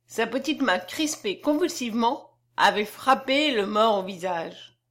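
usual speaking voice

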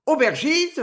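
calls out high and loud for the innkeeper; thundering shout. A narrator's reading. Robbed traveller roars for the innkeeper, thundering shout. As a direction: bellow it across the inn. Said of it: "vociféra-t-il d'une voix de tonnerre".

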